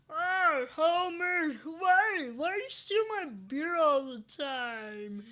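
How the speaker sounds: loud, high cartoon drunkard; exaggerated boozy cartoon wail; Barney Gumble. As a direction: belt out a Simpsons barfly impression. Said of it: "Barney Gumble".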